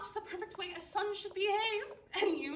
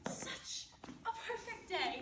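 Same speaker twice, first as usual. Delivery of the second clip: whispered laugh; 3 semitones higher, 9 dB quieter, slower than usual. Acted. Mother laughs it out breathily.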